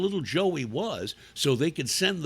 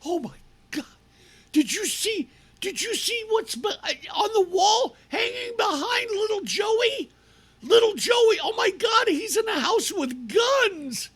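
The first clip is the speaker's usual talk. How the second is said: much higher shrill panicky parent voice, laughing; mock hysterical parent; 13 semitones higher than usual. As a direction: ham up a freaked-out parent. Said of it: "a concerned parent goes".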